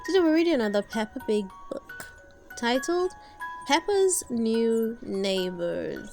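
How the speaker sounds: plain reading voice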